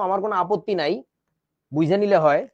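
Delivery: ordinary talk, nothing marked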